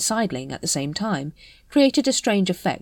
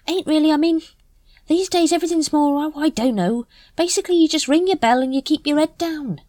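Nobby character voice; 11 semitones higher, 4 dB louder than usual. A narrator's reading. Nobby rambling about the watch.

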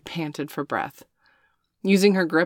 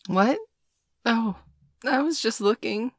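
bashful; 7 semitones higher than usual. A narrator's reading. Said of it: "she said bashfully".

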